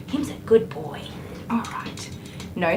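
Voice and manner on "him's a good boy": soft whispered praise. Real, not acted. Groomer praising the dog in a whisper. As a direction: coo it under your breath.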